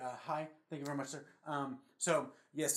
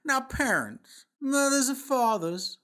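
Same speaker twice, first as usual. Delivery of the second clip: mock PSA warning; 3 semitones higher, 6 dB louder than usual. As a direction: boom it out like a public service alarm.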